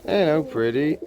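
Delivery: high-pitched cooing hello; singsong pet voice; doting pet greeting. Real, not acted. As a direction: greet a pretty bird adoringly. Greeting another pigeon.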